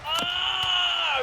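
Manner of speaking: high, drawn-out shout of disbelief; excited shout. Real, not acted. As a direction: yell as the kickout lands. Pinfall broken, reacting live.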